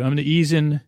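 normal talking voice

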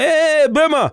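high, loud calling into the phone; mock phone shout. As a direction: bellow a greeting down a bad line. Father shouting into his phone.

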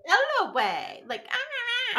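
high mock drawl of the name; imitating her drawn-out L O A. Podcast host mimicking a reality star saying her name, mock drawl. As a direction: mimic her dragging the letters out. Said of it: "the way she was saying".

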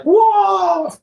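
high, loud, astonished whoa; imitating a wowed American; amazed whoa. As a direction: yelp it like a wide-eyed American. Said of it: "Americans were like".

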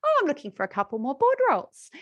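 incidental. Shown as a demonstration. Coach modelling an ask to listeners, incidental and light. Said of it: "You've got to be like".

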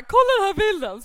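loud, high excited call; excited exclaim. Retold or imitated. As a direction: shout it out, thrilled to show the picture. Excited friend showing a photo.

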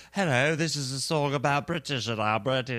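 mocking a bad fake British accent through a laugh; hammed-up posh British voice, giggling; terrible British accent. Mocking a pop star's fake British accent, laughing. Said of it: "She totally does this terrible British accent".

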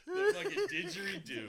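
high, laughing exclamation. Real, not acted. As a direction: squeal it through laughter. Laughing recollection.

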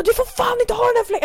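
high-pitched, strained mock shout; imitated angry yelling. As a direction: raise your voice as an irritated colleague would. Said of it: "han bara höjer rösten".

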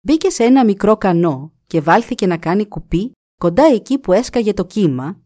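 ordinary delivery, nothing marked

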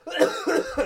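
sputtering cough on a drink; choking cough. Real, not acted. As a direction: cough hard, it went down the wrong pipe. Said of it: "I went down the wrong pipe".

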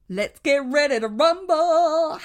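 high, loud drawn-out announcer call; ring announcer. Acted. Co-host opening the story segment, mock ring announcer. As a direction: belt it out like a fight announcer.

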